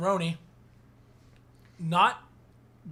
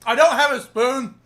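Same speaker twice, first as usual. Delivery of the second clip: loud protest; 6 semitones higher, 10 dB louder than usual. Real, not acted. Eating, shouting across the room.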